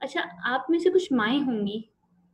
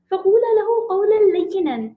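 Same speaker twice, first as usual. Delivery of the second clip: raised, louder chanted recitation of the verse; Quran recitation; 7 semitones higher, 8 dB louder than usual. Acted. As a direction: recite it out with feeling, higher and louder. Quotes Quran verse in lecture. (بلند آواز میں قرآنی تلاوت)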